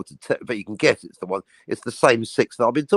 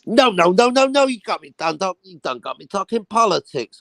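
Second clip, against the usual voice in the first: film character quote; 7 semitones higher, 4 dB louder than usual. Retold or imitated. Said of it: "Have you seen The Hateful Eight".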